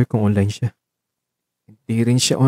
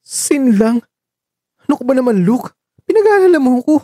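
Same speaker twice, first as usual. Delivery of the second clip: much higher, rising to an indignant cry; exasperated worry; 13 semitones higher, 3 dB louder than usual. Acted. Marco reacting when Luke only seens his message, indignant cry. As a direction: cry out in worried disbelief.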